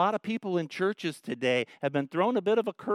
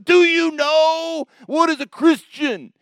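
very loud, much higher, slow preacher roar; pulpit-pounding yell; 13 semitones higher, 10 dB louder, slower than usual. Retold or imitated. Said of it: "the guy pounds the pulpit".

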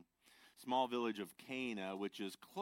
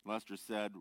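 normal talking voice

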